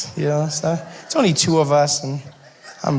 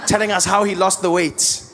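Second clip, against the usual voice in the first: normal talking voice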